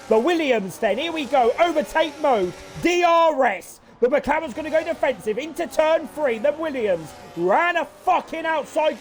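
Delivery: rising race-caller thrill; excited commentary. Real, not acted. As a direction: pitch climbing, fast and urgent play-by-play. Overtake happening live in race.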